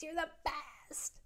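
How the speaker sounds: drawn-out, breathy whispered gush; gushing goodbye. Retold or imitated. Woman thanking her admirer as she leaves, gushing. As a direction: stretch it out in an exaggerated mock whisper.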